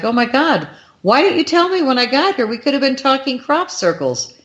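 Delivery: high-pitched, amazed protest; breathless amazement. Retold or imitated. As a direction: exclaim it high, stunned by the news. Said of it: "I'm breathtaking".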